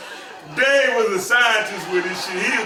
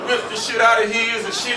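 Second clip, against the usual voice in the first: usual speaking voice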